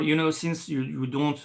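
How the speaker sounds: ordinary delivery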